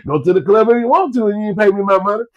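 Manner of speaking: ordinary talk, nothing marked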